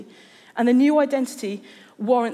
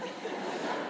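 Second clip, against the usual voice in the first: breathy laugh; 14 dB quieter than usual. Real, not acted. Laughs at the story.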